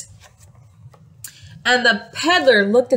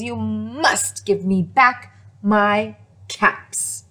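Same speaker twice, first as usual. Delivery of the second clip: very angry; slower than usual. Acted. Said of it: "really very angry".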